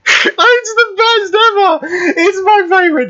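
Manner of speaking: high squeal through laughter; helpless laughter. Real, not acted. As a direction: stretch it out, nearly crying with laughter. Overcome with laughter again.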